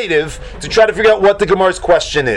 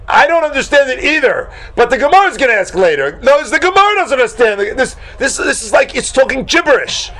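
high-pitched, worked-up protest; agitated, high-pitched incomprehension; exasperated bafflement; 9 semitones higher than usual. Acted. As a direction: protest that none of it makes sense. Voicing Rashi's exasperated bafflement.

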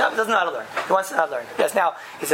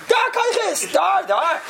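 much higher, emphatic exclaiming; excited insistence; 11 semitones higher, slower than usual. Retold or imitated. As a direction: cry it out high with conviction. Insisting in retold argument.

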